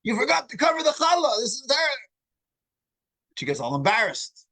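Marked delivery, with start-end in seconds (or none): 0.0-1.8 a nervous host snapping at his wife; shouting at wife. Retold or imitated. Host scolds his wife in front of the rabbi, high angry shout. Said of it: "So he shouts at his wife".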